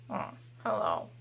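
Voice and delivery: shy puppet whisper. Pretend. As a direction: let the potato breathe a timid hello. Puppet potato answers.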